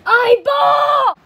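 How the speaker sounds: high, loud yell; loud, drawn-out shout; shouted call. Acted. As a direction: holler the name at full voice. Calling out again, much louder.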